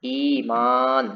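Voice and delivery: Iman and Yasmin very slow with the ई held long; drawn-out words. Shown as a demonstration. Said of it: "मीन खींच के लिखना हो". (ई खींचकर ईमान, यास्मीन)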